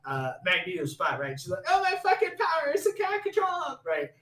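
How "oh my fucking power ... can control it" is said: high, rushed mock-dramatic whine; hammed-up panic, racing and high; mocking outburst. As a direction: blurt it like an overwrought movie heroine. Mocking film character's dramatic line.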